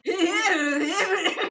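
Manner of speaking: shrieking with laughter, almost crying; helpless shrieking laugh. Real, not acted. Laughing too hard to keep reading aloud. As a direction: lose it completely, laughing high and loud. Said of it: "Actually, it's more like".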